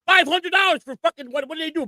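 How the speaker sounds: high-pitched, incredulous outburst; indignant disbelief. Real, not acted. Outraged at price of haircut.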